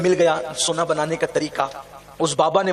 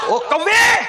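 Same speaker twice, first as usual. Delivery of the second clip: high, loud call to the crow; 14 semitones higher, 7 dB louder, much slower than usual. Retold or imitated. Baba Farid cries out to the crow, loud and high. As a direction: shout it out to the crow.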